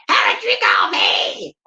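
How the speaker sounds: bellowed rock-singer impression; gruff rock growl. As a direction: growl it like a hoarse rocker. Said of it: "sounded more like".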